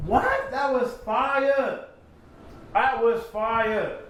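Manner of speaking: high, slow, drawn-out amazement; hyped reaction. Real, not acted. Viewer reacting to a bar, drawn-out hype. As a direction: react like the bar just hit you.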